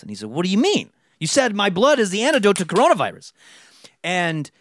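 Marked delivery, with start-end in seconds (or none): 0.3-3.3 mock outraged, pitch jumping up; incredulous protest. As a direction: protest as if you were promised otherwise. Voicing Doug's shocked objection to rejection.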